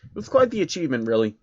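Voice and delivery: ordinary delivery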